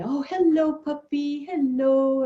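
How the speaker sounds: put-on high, sweet baby-talk coo; cooing at a puppy. Greeting a huge playful puppy in the park, high cooing. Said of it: "and cooed the dog".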